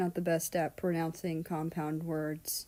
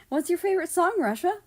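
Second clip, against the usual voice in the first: much higher and louder, bouncy question; eager Japan; 11 semitones higher, 7 dB louder, faster than usual. Acted. Japan hopping up to quiz Russia, high and eager. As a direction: hop in excited and curious.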